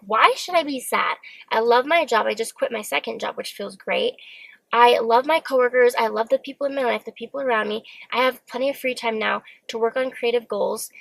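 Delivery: ordinary delivery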